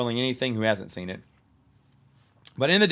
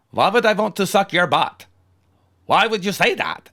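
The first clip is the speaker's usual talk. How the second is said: higher, louder mock-offended vampire voice; indignant vampire; 7 semitones higher, 4 dB louder than usual. Vampire to the old lady, hammed-up offended vampire voice. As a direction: play the scandalized vampire, pitched up. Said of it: "And I said".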